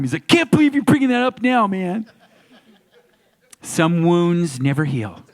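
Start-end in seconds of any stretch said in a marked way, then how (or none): 0.3-2.1 high, fast aggrieved Corey voice; Corey Feldman impression. [name] imitating Corey Feldman grumbling at being reminded. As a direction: rush it out like a sulking Corey. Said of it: "excellent Corey Feldman impersonation".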